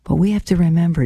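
normal talking voice

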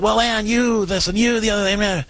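high, blaming husband voice mocked; wife mimics her angry husband's complaint, high and mocking. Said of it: "at first he's gonna be like".

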